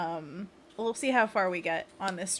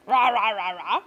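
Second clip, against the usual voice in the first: louder playful monster rah; monster growl; 8 dB louder than usual. Shown as a demonstration. Streamer leading the third pose, playful monster roar. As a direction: growl along like a cartoon monster. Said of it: "and then curl 'em in".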